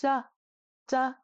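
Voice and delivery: breathless consonant in "Ja"; no aspiration. Shown as a demonstration. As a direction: say "Ja" without fluttering a tissue. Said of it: "No flutter".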